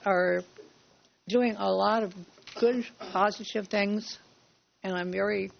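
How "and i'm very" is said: cough drop in mouth. Real, not acted. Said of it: "gotta have a cough drop stuck to the roof of my mouth".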